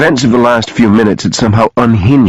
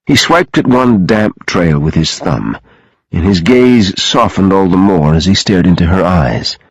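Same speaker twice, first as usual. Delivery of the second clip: ordinary delivery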